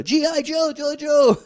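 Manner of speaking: a boy voicing his action figure; kid playing with toys. Kid voicing toy hero.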